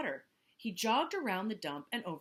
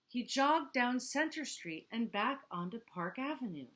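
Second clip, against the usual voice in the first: ordinary delivery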